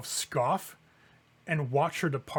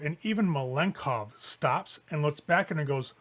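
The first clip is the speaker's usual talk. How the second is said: ordinary talk, nothing marked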